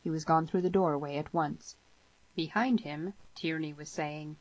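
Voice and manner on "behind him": ordinary delivery, nothing marked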